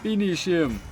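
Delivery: high booming barbarian announcer voice; Conan the Barbarian announcer. Acted. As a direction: bellow it like a fighting game announcer. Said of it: "I'm using the Conan the, uh, Barbarian announcer voice".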